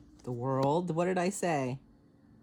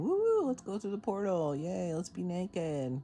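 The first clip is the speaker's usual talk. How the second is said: high, drawn-out playful whoop; gleeful cheering; 5 semitones higher than usual. Acted. Card reader mimics herself dancing through the portal, gleeful cheering. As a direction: sing out a carefree celebration, stretching the ending. Acts out carefree joy at portal.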